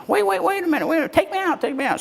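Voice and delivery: very high frantic frog voice; panicky frog. Acted. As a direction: squeal from inside the pocket. Frog in pocket protests.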